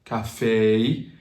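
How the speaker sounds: CAFÉ with final AY sliding to EE; anglicized "café", the mouth moving on the last vowel; gliding English vowel. Shown as a demonstration. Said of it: "you change the mouth".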